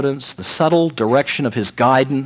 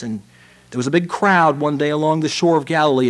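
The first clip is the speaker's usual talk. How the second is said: ordinary talk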